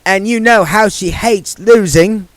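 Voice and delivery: high, lightly voiced young woman; Margaret's voice. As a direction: lift the voice for the young witch.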